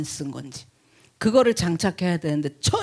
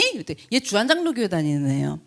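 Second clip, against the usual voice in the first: ordinary talk, nothing marked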